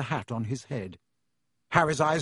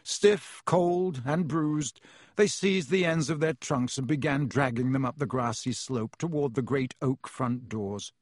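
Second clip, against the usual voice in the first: ordinary delivery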